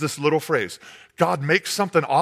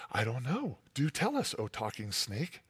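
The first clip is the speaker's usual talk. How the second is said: naive Eve; 5 semitones lower, 12 dB quieter than usual. Acted. Adam and Eve answering the snake.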